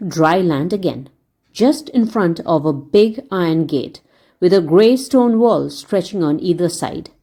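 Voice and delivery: ordinary delivery, nothing marked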